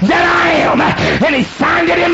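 high, loud, sing-song preaching; whooping preacher. Acted. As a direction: ride the climax of the sermon. Preacher whoops at full force.

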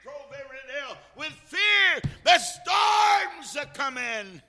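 high shouted exhortation with long stretched key words; full-force preaching. Real, not acted. Preacher urging the saints to move with fear, shouted preaching. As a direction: cry out the warning slowly, at the top of your voice.

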